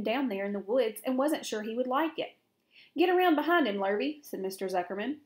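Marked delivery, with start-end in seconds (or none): 3.0-4.2 raised, firm command to a farmhand; calling out orders. A narrator's reading. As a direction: call it out louder, higher at the start. Farmer calls out orders to hand.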